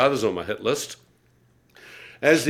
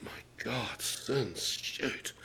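a hushed, horrified whisper; shocked whisper; 16 dB quieter, much slower than usual. Retold or imitated. A sinner whispering to himself in shock, hushed. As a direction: breathe it out in dismay.

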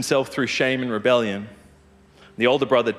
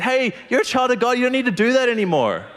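reassuring; 11 semitones higher, 3 dB louder than usual. Pretend. Spirit's voice testifying, gentle.

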